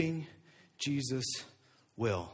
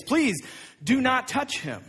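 high, loud plea; pleading cry; 8 semitones higher, 5 dB louder, slower than usual. Acted. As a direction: beg him to stop. Disciples pleading with Jesus.

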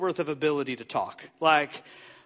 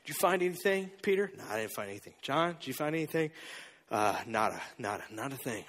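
disciples muttering; 7 semitones lower, 8 dB quieter than usual. Acted. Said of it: "they're like".

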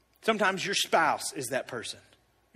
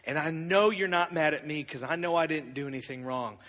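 normal talking voice

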